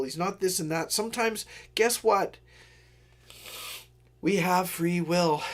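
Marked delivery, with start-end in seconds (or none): 1.7-5.4 slow, weighty, mock-grand; dramatic pronouncement. Shown as a demonstration. Telling viewers the punchline slowly and mock-grandly. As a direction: let it land slowly like a big reveal. Punchline, then laughs and repeats.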